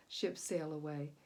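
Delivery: ordinary delivery, nothing marked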